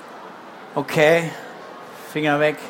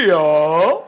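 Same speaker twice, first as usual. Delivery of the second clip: donkey shout; 4 semitones lower than usual. Acted. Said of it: "schreit der Esel".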